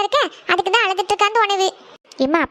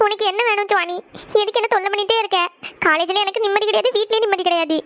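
tearful, exasperated complaint; crying. Acted. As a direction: complain to sister through sobs. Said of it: "எதுக்கு அழுதுட்டு இருக்கேன்னு". (அழுதபடி புலம்பல்)

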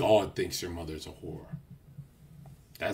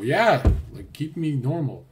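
higher, louder mock sincerity; mock earnest plea; 6 semitones higher, 8 dB louder than usual. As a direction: overplay being needy. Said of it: "I go".

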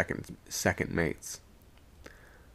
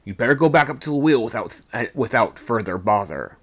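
raised, insistent urging; firm remonstrance; 2 semitones higher, 6 dB louder than usual. A narrator's reading. Second mate to refusing sailor, firm remonstrance. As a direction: press him to obey.

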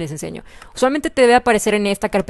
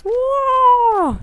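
asombro. Real, not acted. Edificio terminado, asombro.

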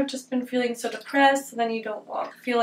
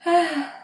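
higher, falling sigh; weary sigh; 6 semitones higher than usual. Real, not acted. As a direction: let out a real sigh. Opening up about feeling depressed.

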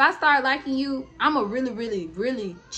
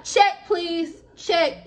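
demanding the check; 7 semitones higher, 4 dB louder, much slower than usual. Retold or imitated. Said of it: "I was like".

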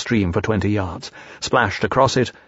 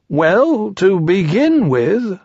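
high, slow bear voice; high drawn-out bear; Pooh; 9 semitones higher, much slower than usual. A narrator's reading. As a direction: begin ponderously in a raised storybook voice. Pooh starts explaining.

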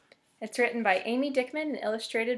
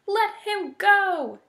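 slow, loud, raised demand; 9 semitones higher, 6 dB louder, much slower than usual. A narrator's reading. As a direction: draw out each word as a firm order. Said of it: "Dot demanded".

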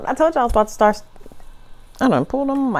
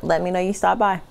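normal talking voice

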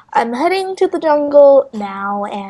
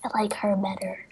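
whispering; 2 semitones lower, 8 dB quieter than usual. Real, not acted. Whispers about a character.

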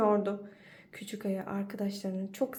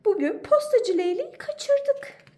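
high, louder rabbit lament; upset rabbit; 12 semitones higher, 6 dB louder than usual. Acted. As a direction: rabbit voice, sorry they missed him. Rabbit laments missing stork.

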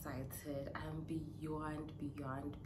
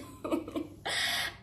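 tearful laugh; 5 dB louder than usual. Real, not acted. Laughing after car news.

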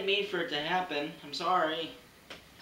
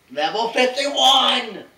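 drawn-out, higher offended yell; slow indignant shout; 6 semitones higher, 10 dB louder, much slower than usual. Acted. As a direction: drag every word out, outraged. Insulted gamer repeats, slower.

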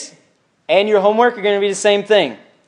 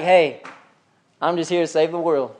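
softer, casual throwaway delivery; mock easygoing Jesus; 3 semitones lower, 5 dB quieter than usual. Imagined Jesus introducing himself, laid-back and mild. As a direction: say it mildly, as if nothing is wrong. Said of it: "You think he's like".

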